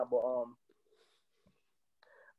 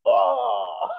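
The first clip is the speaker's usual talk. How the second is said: loud, raised "oh" of a dismayed crowd; mock crowd groan; 5 semitones higher, 4 dB louder than usual. Crowd seeing the comic come back on stage, mock groan. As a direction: imitate a whole audience groaning.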